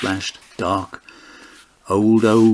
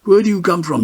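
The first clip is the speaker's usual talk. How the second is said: higher, louder official question; a hint of a buttoned-up constable; stiff policeman; 10 semitones higher, 5 dB louder than usual. A narrator's reading. Policeman questioning the drinkers, stiff and louder. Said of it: "his buttoned blue voice".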